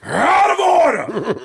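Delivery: very slow build into a high shout; Al Pacino. Acted. Voice actor reaching his cartoon climax, as a shouting Al Pacino. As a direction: explode at the end in actor parody. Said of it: "me as Al Pacino".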